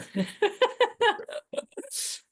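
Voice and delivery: long high-pitched giggling laugh; real laugh. Real, not acted. Amused at forgetting to thank husband.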